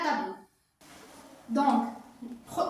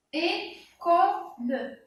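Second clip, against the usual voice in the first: mot étiré; 4 semitones higher, 4 dB louder, much slower than usual. Shown as a demonstration.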